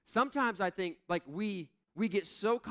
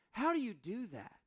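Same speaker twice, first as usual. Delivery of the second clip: put-on kid's question; 7 semitones higher than usual. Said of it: "my kids say".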